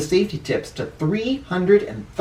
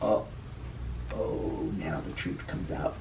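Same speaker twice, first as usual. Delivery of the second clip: soft, low, almost whispered aside; hushed dramatic aside; 9 semitones lower, 15 dB quieter than usual. Real, not acted. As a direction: lean in and drop the voice. Reader reacts to Buckle's discovery.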